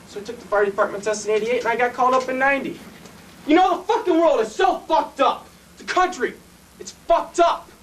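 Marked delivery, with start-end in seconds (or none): 3.5-7.7 high, forceful rant; heated, raised-voice complaint; bitter outburst. Acted. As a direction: rail at the state of things. Railing at state of world.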